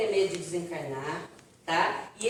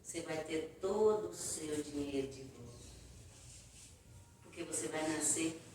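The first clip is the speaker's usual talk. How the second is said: much softer, gentle promise; soft reassurance; 5 semitones lower, 12 dB quieter than usual. Retold or imitated. Mentor gently reassures the grieving dead man, very soft. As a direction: hush it like a kind secret.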